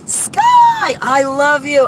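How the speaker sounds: high squealed name; excited greeting. Real, not acted. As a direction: greet her like you've missed her. Greeting a friend joyfully.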